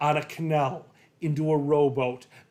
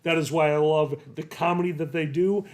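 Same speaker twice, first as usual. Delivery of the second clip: ordinary delivery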